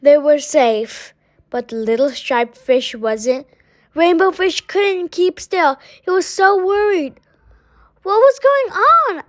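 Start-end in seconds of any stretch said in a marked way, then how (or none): 3.9-7.2 higher, anxious telling; worried narration. A narrator's reading. Narrator telling how Rainbow Fish frets, anxious. 8.0-9.2 much higher, urgent question; alarmed question. A narrator's reading. Skinny fish asking what is wrong, alarmed. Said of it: "asked the skinny fish".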